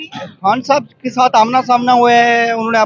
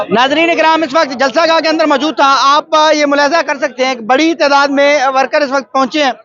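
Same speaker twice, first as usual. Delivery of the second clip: higher, louder presenter voice; raised on-camera announcing voice; field news report; 5 semitones higher, 3 dB louder than usual. Real, not acted. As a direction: address the viewers as a TV reporter. Reports from the rally ground.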